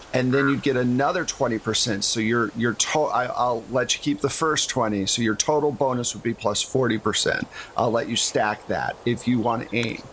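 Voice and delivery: ordinary talk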